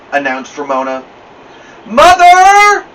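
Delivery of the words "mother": long, high, loud wail. A narrator's reading. Beezus wailing to Mother about the ears. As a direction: wail it out in protest. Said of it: "wailed Beezus".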